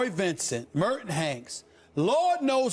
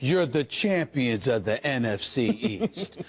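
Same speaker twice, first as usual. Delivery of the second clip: sarcastic congratulation; 12 semitones lower, slower than usual. Pretend. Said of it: "let me look into the camera at those Redskins players".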